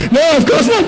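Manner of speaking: fake calm denial.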